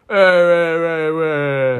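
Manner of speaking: slow drawn-out braying jeer; mock parliamentary braying. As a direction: imitate MPs jeering across the House. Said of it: "they're just going".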